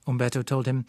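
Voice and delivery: usual speaking voice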